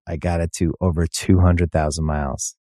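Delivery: ordinary delivery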